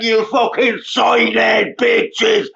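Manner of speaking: slow loud drawn-out insult; mock shouted insult.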